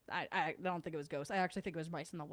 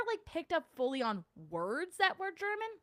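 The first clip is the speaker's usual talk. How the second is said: usual speaking voice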